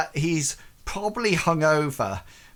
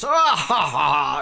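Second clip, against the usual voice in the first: hearty laugh; 5 dB louder than usual. Acted. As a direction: belly laugh of a jolly lord. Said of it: "And Reginald goes".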